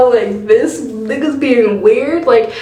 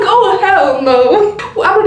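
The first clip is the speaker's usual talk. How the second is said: laughing disbelief; 9 semitones higher, slower than usual. Retold or imitated. Laughing disbelief at little brother.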